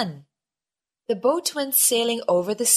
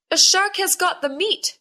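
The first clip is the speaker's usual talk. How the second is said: high, loud alarm; shouted; 10 semitones higher, 4 dB louder than usual. A narrator's reading. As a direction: shout a warning to the crew. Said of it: "shouted the sailors".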